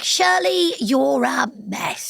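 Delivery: high, then slow teasing dog voice; dog voice for Shirley. Shown as a demonstration. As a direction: tease the dog fondly. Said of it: "completely different".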